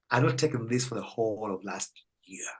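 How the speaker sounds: hushed confiding whisper to the doctor. Retold or imitated. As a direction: lean in and whisper the secret.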